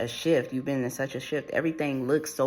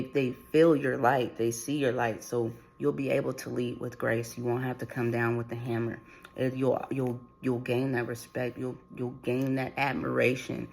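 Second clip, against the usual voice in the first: ordinary delivery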